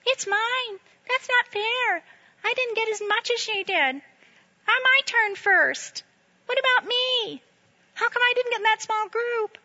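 put-on very high whining child voice; mock whiny child complaining. Mocking childish church members.

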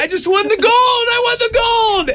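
high-pitched mock victory cry; acted celebration, voice shooting up high; mock elation. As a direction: crow like a champion, hammed up. Acting out the athlete's celebration.

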